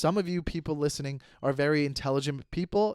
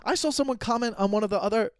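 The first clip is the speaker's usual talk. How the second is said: ordinary talk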